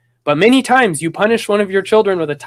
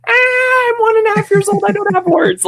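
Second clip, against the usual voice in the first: put-on much higher squeaky little-kid voice; toddler voice. The toddler answers his parents in a high baby voice. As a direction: pipe up like a baby answering back.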